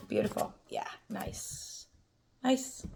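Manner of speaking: breathy whispered praise for the cards; whispered delight. Real, not acted. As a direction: whisper it to yourself, pleased. Soft murmured reaction to cards.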